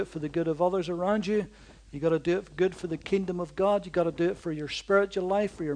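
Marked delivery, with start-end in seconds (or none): none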